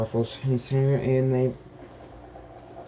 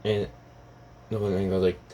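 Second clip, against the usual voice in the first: usual speaking voice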